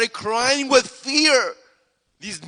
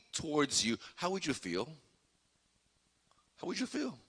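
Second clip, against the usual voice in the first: much softer, letting the question hang; hushed question; 6 semitones lower, 16 dB quieter than usual. Real, not acted. Preacher asks listeners to imagine their fear, hushed. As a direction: suddenly drop to a soft, intimate ask.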